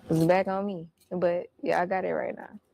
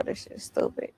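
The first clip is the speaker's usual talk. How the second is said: muttered aside; 3 dB quieter, faster than usual. Retold or imitated. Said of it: "It was just like".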